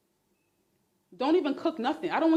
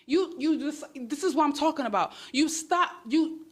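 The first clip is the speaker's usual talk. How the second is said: usual speaking voice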